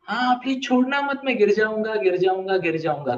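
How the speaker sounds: high-pitched plea, sliding down as it repeats; mock-panicked pleading on a bicycle; pleading young learner. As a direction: act a learner begging not to be let go. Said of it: "बोलते थे जो सिखा रहा था उसको".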